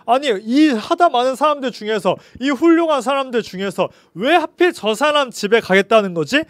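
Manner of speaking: as if you can't believe what you just heard; baffled outrage. Acted. Crowd questioning why Jesus picks a sinner, loud and indignant. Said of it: "너무나도 황당해하고 어이없어했어요".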